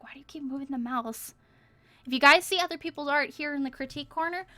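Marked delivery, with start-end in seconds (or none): 0.0-1.3 a hint of a put-upon cat, said softly; grumpy cat voice. Streamer voices her cat complaining about the mouse, lightly grumpy. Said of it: "He's like".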